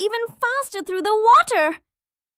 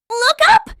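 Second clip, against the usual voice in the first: excited call; 7 semitones higher, 8 dB louder than usual. Acted. Flying up into sky.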